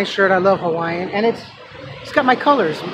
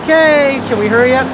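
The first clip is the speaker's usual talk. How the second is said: louder and higher, sharp impatience; impatient; 6 semitones higher, 7 dB louder than usual. Retold or imitated. Anxious bus rider pleading with the bus, loud and impatient. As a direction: hurry the bus along in your head. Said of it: "and I'm like".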